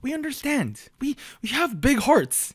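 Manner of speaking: sarcastic, pitched high, mock-benevolent; mock generous. Mocking K-pop's bad English, mock generous. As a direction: play the patient saint, tongue in cheek.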